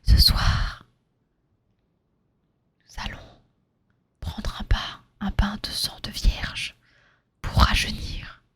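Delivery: slow, soft ASMR whispering. Acted. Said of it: "petit chapitre d'A S M R".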